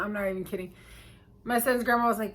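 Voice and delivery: plain reading voice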